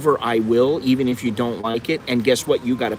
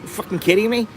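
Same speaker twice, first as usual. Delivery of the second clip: a scoffing, higher "you kidding me"; scoffing disbelief; 6 semitones higher than usual. Retold or imitated. Buddy scoffing at the question about a girlfriend, higher and incredulous. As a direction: scoff at the idea like it's absurd. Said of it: "He goes".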